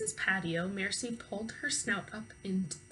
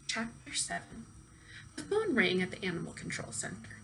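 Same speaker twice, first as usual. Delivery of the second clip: plain reading voice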